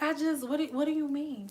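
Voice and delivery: soft, unruffled feigned innocence; cool, collected. Acted. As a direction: play innocent in a hushed, calm voice. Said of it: "most cool and collective ass voice".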